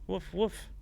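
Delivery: high, loud woofs. Acted. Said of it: "it should bark louder".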